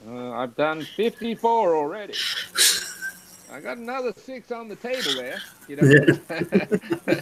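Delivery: put-on old man's proud boast, pitched high; gleeful boast. Friend boasting to her about mailing insurance envelopes, gleeful. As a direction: crow it like a cheeky old man.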